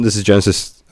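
ordinary delivery

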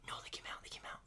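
urgent whisper. Retold or imitated. Hunting buddy whispering that the elk came out. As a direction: hiss it out so they won't hear.

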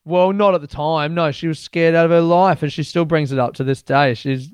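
usual speaking voice

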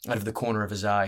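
ordinary delivery, nothing marked

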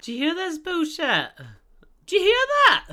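high, loud incredulous shout; outraged yelling. Real, not acted. Host replaying friend's empty response, outraged shout. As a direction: yell it in mock disbelief.

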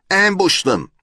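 high, loud eager suggestion; acted high-pitched child's shout; excited calling out. A narrator's reading. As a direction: shout it out like a keen kid. Kids shouting out rapid suggestions.

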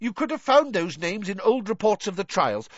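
high, irritated rebuttal; blustering, scornful official; angry. A narrator's reading. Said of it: "said Fudge angrily".

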